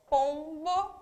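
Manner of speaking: "Pombo" drawn out with POM stressed; penultimate stress. Shown as a demonstration. Teacher showing a paroxytone, stressing POM in "Pombo". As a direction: lean on the first syllable of "Pombo". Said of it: "paroxítono".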